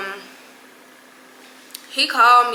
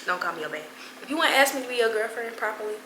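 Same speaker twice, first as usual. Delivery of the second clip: usual speaking voice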